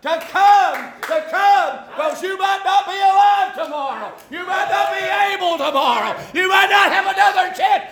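loud, high preaching with repeated pleas; fiery altar call. Acted. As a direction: urge them to come now, full force.

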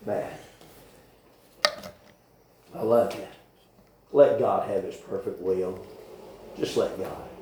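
tender, quiet urging; a soft, low plea to the church. Real, not acted. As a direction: ease them gently toward God. Tender close of sermon.

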